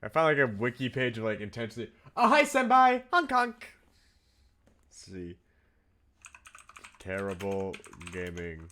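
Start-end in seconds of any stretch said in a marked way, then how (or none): 2.1-3.7 high, loud goofy honking greeting; silly clown. Pretend. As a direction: play the clown, honk it out. Greets viewer with clown honk.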